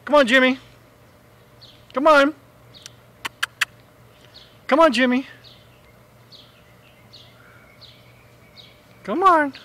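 calling a pet. Real, not acted.

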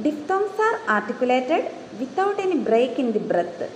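very slow, deliberate textbook reading; dictating a definition slowly; slow dictation read. Shown as a demonstration. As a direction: read each word out for note-taking. Reads English definition slowly.

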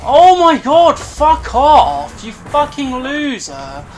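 high, loud raging yell at an opponent who beat him; furious yell. Real, not acted. Gamer yelling at the rival who just killed him, furious. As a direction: shout him down, fuming.

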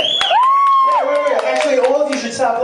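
high, loud yell; shout at crowd. Real, not acted. Comic yelling at the crowd to stop laughing, loud. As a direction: bark it at the audience.

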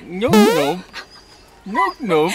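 high, loud puzzled question; baffled high voice. Real, not acted. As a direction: repeat it in disbelief. Baffled repeating.

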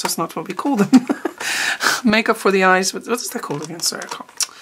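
ordinary talk, nothing marked

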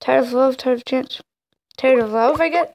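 ordinary delivery

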